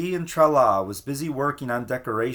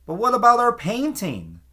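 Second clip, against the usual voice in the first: high, louder protest from the crowd; shouted question; 10 semitones higher, 4 dB louder than usual. A narrator's reading. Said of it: "someone shouted".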